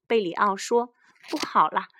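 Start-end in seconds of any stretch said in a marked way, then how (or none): none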